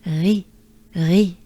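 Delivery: ri with a throaty French R, lips in a wide smile. Shown as a demonstration. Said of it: "let's take a good breath".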